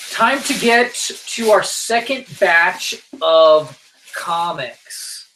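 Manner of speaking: slow, stretched segment intro that fades at the end; host introducing the comics segment, mock announcer; drawn-out announcer. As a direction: announce the next batch like a show host.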